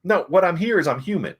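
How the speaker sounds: high-pitched retold indignant plea; acted pleading. Acting hero's plea to protesters.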